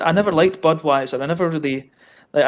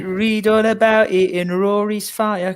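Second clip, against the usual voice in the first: slow, higher paperboy call; newspaper seller cry; 5 semitones higher, slower than usual. Pretend. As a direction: hawk it like a street paperboy.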